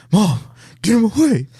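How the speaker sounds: high, pleading whine; a frightened kid begging his mom; scared kid. Retold or imitated. Kid hiding behind his mom, high pleading whine. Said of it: "And he's just like".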